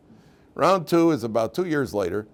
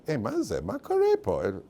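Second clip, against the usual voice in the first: mock indignant Israeli; 5 semitones higher than usual. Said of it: "if anybody would say".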